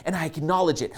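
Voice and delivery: ordinary talk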